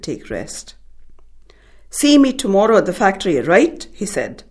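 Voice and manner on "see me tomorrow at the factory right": loud, fast, irritated brush-off; annoyed dismissal. A narrator's reading. Disturbed gaffer brushing off the family, annoyed and loud. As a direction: snap it out to get rid of them.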